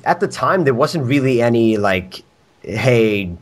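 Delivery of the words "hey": ordinary talk, nothing marked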